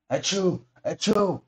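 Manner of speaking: high, loud double sneeze. A narrator's reading. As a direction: sneeze twice, high and sharp. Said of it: "And he sneezed again twice".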